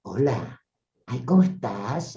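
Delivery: hushed affectionate hello; sweet self-talk. Shown as a demonstration. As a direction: coo it gently like to a loved one. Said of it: "Hablate a vos así".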